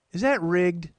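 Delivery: high, loud suspicious lady voice; Dottie. Retold or imitated. Mother's neighbor quizzing a game-show contestant, high and loud. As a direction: pipe the question up as a blunt neighbor woman. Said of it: "She goes".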